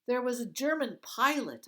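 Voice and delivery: high, loud, bright-eyed question; eager girl. Acted. Girl distracts the guard, eagerly high and loud.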